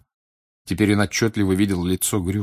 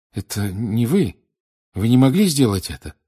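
higher, louder, stunned protest; disbelief; 3 semitones higher, 4 dB louder than usual. Acted. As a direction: refuse to believe it. Boy disbelieves confession. (потрясённо, не веря)

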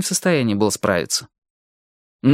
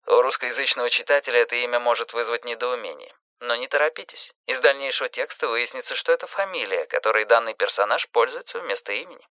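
softer, understated aside to the reader; narrator's footnote; 5 dB quieter than usual. A narrator's reading. Narrator explains a name to the reader, soft aside. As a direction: drop the volume for a bracketed note.

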